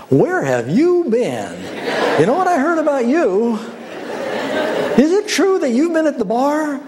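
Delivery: hammed-up busybody, relishing the scandal; nosy gossip. Preacher mocking a churchgoer greeting a returner, giggly gossip. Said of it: "please don't say to them".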